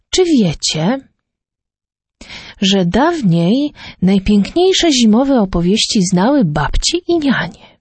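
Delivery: ordinary delivery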